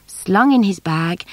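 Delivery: plain reading voice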